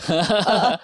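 ordinary talk, nothing marked

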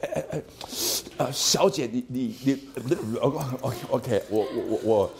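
halting, stammering mock-nervous young man; nervous suitor. Lecturer mimics a nervous boy asking a girl out, halting. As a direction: play the shy boy tripping over his words. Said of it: "他会有点紧张的问你说".

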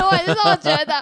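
high, loud laugh then agreement; real laughter. Real, not acted. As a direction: laugh along as you agree.

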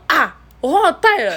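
high, sheepish oops; a light high impression of the friend; sheepish friend's voice. Friend admits forgetting, voiced.

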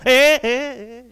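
high, loud, slow "哎哎哎哎" of a swerving rider; mock alarmed cry. Comedian mimics a scooter rider passing the traffic cop, high slow cry. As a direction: wail it like someone barrelling past a cop.

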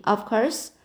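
plain reading voice

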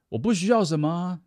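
high, loud mock bragging; mocking boast. (高声夸张模仿逞强的人)

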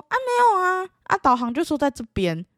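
mock taxi driver. Taxi driver voiced, arguing.

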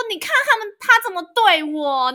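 high, loud mock whine, slowing at the end; hammed-up plea for sympathy; whiny complaint. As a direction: play the wronged victim. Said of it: "我就说".

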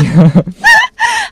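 high shrieking laugh; loud burst of laughter. Real, not acted. Reacting to slow reading. (大笑)

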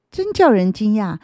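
ordinary delivery

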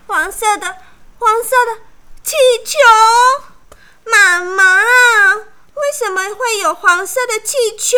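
high, loud wailing complaint; crying whine. A narrator's reading. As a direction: wail it like a spoiled child. Princess upset over yellow balloon.

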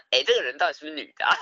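rapid question with a laugh; mock quick streamer chatter; fast laughing streamer. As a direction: rattle it off amused. Streamers wondering.

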